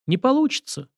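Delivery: feigned regret. A narrator's reading. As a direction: decline with put-on regret. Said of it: "сделанным сожалением". (с деланным сожалением)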